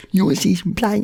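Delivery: high, loud bloke voice; blokey mate. Retold or imitated. Mate mocking Barry's art plan, loud and high. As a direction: scoff like a bigger bloke.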